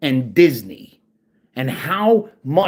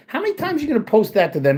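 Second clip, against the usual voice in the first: much higher, fast, exasperated; fast angry repetition; 8 semitones higher, faster than usual. Real, not acted. Ranting at a harassing poster, fast and higher. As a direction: fire it off in disbelief.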